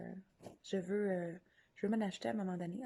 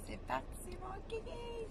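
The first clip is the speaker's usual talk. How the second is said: squeaky high cheer; 13 semitones higher, 5 dB quieter, much slower than usual. Pretend. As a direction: pitch way up, playful.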